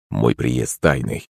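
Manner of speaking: low, slow, softened voice; confiding emperor. A narrator's reading. Emperor saying his visit is secret, low and confiding.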